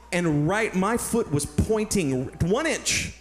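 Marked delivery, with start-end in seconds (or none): none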